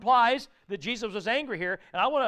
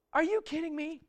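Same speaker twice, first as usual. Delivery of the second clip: incredulous rebuke; 7 semitones higher, 3 dB quieter than usual. Retold or imitated. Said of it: "Jesus said".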